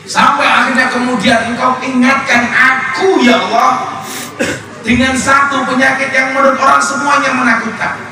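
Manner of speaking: high, pleading cry to God; preacher crying out to Allah in front of crowd; impassioned prayer. Acted. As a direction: preach the confession, voice lifted high. Tearful raised prayer in sermon.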